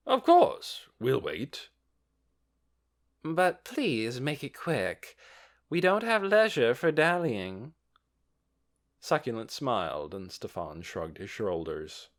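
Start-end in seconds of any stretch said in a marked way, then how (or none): 0.1-7.7 pushy merchant voice. A narrator's reading. Succulent answers impatiently.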